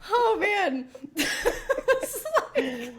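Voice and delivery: high, breathless laughter through words; laughing. Real, not acted. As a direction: laugh it out helplessly. Laughing fit.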